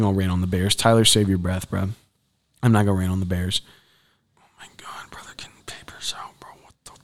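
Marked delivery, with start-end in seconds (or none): 4.4-6.9 barely audible stunned whisper; hushed whisper of disbelief; shocked whisper. Real, not acted. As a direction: whisper in disbelief as someone barges in. Whispering as someone walks in.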